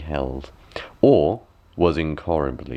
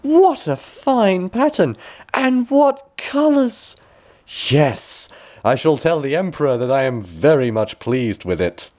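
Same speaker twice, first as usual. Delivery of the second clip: fake delight; 10 semitones higher, 3 dB louder than usual. A narrator's reading. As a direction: gush with false praise, much higher. Minister feigning delight.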